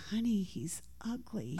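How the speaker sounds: a husband whispering into his wife's ear; whispered in ear. Retold or imitated. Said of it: "got in my ear".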